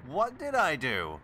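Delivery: indignant shout. Acted. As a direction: protest it loudly and slowly. Escalating denial.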